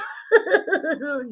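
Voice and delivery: a high bright laugh bursting out; sudden high delighted laugh; burst of laughter. Real, not acted. Applause shoutout, bursting laugh.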